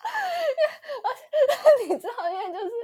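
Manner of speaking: high, loud, breaking into laughter; can't get the words out for laughing; laughing hard. Real, not acted. Recalling the crash to a friend, laughing high and loud.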